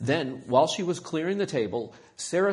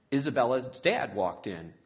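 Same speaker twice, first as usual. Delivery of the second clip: ordinary delivery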